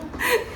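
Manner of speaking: a sudden high laugh, quickly over; burst of laughter. Real, not acted. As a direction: laugh out at the memory. Laughing at the roti story. (अचानक ऊँची हँसी)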